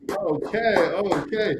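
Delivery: high, amused okay okay; giving in with a surprised chuckle in the voice; won-over customer. Retold or imitated.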